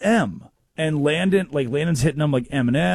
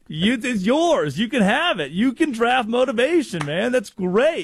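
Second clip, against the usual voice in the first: higher, slowed hyped-up praise; mock cheering; 7 semitones higher than usual. Host retelling praise for Jose's odd answer, hammed-up cheer. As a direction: gush over him like a proud coach.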